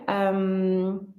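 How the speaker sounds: ordinary delivery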